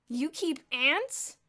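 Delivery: very slow, loud and high, disbelieving; echoed in disbelief. A narrator's reading. A dozen boys echoing Elmer, astonished. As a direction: draw it out in disbelief. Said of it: "echoed a dozen voices".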